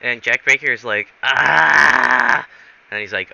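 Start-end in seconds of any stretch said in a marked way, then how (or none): 1.2-2.5 loud, sustained "aah" scream; mock scream. Newly thawed man screams at his rescuer, mock scream. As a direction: yell out one long open cry. Unfrozen character screams in story.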